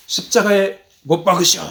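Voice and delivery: half-hearted yell of a demanding mob; mock crowd shout. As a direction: call it out as a restrained imitation shout. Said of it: "이렇게 소리를 지릅니다".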